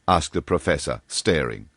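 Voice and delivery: usual speaking voice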